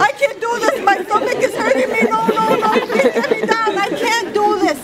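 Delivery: high-pitched, pleading imitation of a scared rider; comically distressed, high voice; mock panic. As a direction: imitate someone begging to get down. Retelling a panicked zipline rider.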